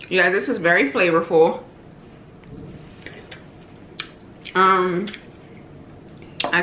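talking while chewing; eating between words; mouth full. Real, not acted. Seen 1: Forkfuls go into her mouth; food visible in mouth at 6.3.

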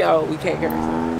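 normal talking voice

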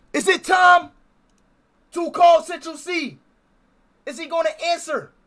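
high shouted question; rally the chat; hyped shout. Real, not acted.

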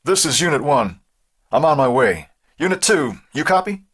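high-pitched voice over a scanner; police radio call. A narrator's reading. Police chief radios his deputy that he's on the way, radio-call voice. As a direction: call another unit on the radio.

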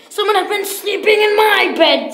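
loud, high storybook bear roar; Papa Bear's great big voice. Acted. Papa Bear finds his bed slept in, great big voice. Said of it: "in his great big voice".

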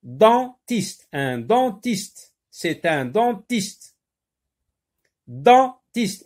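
"dentiste" split into den-tiste each time; slow, careful repeats of "dentiste"; syllable by syllable. Shown as a demonstration. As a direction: make the den in "dentiste" stand out. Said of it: "on entend le mot dent".

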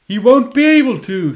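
high, loud villain taunt; gloating villain. Acted. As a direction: taunt the heroes gleefully.

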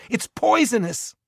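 high, urgent warning call. A narrator's reading. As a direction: shout the warning across. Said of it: "he called".

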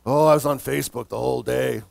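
low, dopey put-on drone; mock busy person. Mimicking people asked about their day, mock weary. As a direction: imitate an idler posing as worn out. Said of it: "The same people I talk to go".

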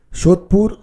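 normal talking voice

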